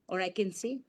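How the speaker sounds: ordinary talk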